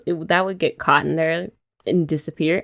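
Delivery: usual speaking voice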